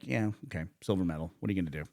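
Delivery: low, soft and unbothered; nonchalant athlete impression. Shooter brushing off his silver medal, nonchalant. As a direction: shrug it off under your breath.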